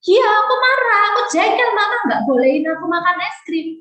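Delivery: put-on high, loud angry child voice. Child yelling at mom over refused ice cream, high and loud. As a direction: play a furious little kid who was denied ice cream. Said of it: "Adik marah".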